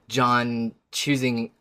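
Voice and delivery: ordinary talk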